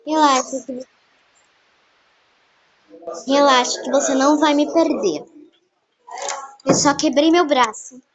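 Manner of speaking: loud pretend call about a broken arm; loud phone voice. On the phone to her friend, faking a broken arm, loud and casual. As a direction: talk up loudly into the phone, faking calm.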